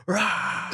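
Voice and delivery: high-pitched mock roar; comic high yell, not full volume; imitated yell. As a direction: roar like a pinned, struggling kid. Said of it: "like, yelling the whole time".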